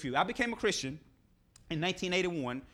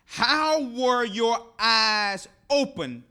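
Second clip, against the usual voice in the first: demanding; 3 semitones higher, 7 dB louder, much slower than usual. Acted. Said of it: "they demanded".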